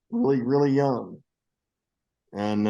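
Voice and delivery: ordinary talk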